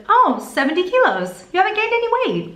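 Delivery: high, bright-pitched chirp of good news; cheery nurse. Retold or imitated. Nurse chirping to the patient, high and happy. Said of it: "she said".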